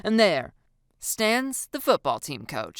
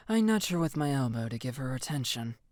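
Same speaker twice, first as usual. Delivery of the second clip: ordinary delivery, nothing marked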